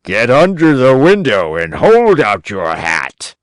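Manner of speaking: put-on sly old man shouting from above; old man cries out. A narrator's reading. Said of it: "the same voice cried".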